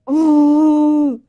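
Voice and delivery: loud anguished "oh" that cracks; frustrated cry. Real, not acted. Embarrassed streamer crying out in frustration to Cloud. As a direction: wail it out in real frustration.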